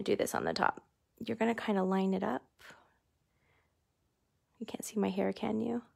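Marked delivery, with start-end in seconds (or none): 4.6-5.8 soft whispered aside. Real, not acted. Host asking live viewers, whispering. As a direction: hush it like a secret.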